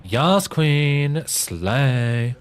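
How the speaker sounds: high, loud hyped-up diva cheer; campy slay voice. Pretend. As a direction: squeal it like a camp cheerleader hyping a queen. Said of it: "Can you do a slay with your great voice acting?".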